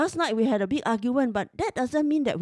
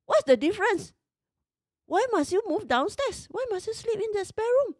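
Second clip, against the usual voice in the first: pitched way up in angry disbelief; exasperated demand; 12 semitones higher than usual. Retold or imitated. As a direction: hurl the questions at him, high and sharp. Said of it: "I said".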